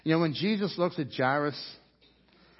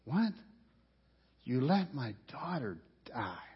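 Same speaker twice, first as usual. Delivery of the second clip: usual speaking voice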